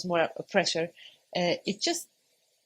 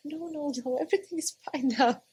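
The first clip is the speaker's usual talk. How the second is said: reassuring midwife; 7 semitones higher, faster than usual. Retold or imitated.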